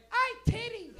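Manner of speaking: very high, loud mock shriek; frantic mother impersonation. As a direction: squawk it like a panicked mom. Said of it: "But it's never like".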